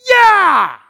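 high, very loud stadium cheer; victory yell. Shown as a demonstration. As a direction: shout like your team just won. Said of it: "what do you do?".

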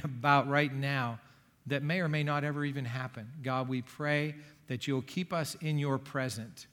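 prayer. Real, not acted. Leads the congregation in prayer.

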